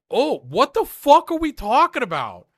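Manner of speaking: loud, high and disbelieving; incredulous outburst. Retold or imitated. Friend reacting to the show, loud and incredulous. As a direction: blurt out what are we talking about. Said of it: "And it's like".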